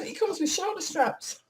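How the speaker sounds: ordinary talk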